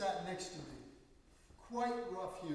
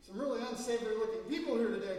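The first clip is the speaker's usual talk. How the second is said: louder, quick, careless comment; loud remark; 4 semitones higher, 8 dB louder, faster than usual. Retold or imitated. Newcomer comments on the congregation, loud careless remark. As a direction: say it so the whole church hears.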